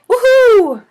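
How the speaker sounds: high, loud cheer; yell of joy. A narrator's reading. Boy cheering that it's lunchtime, loud high woohoo. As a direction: whoop it out, fist in the air. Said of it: "I yelled".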